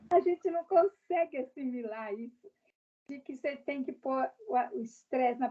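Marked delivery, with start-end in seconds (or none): none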